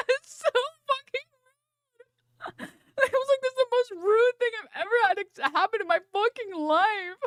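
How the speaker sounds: high, laughing, half-crying indignation; laughing outrage. Real, not acted. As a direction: squeal it, cracking up at the rudeness.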